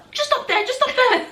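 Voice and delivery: high, lightly mocking offhand directions; imitated casual man. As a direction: imitate a man breezily waving them on. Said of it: "he's just so casual".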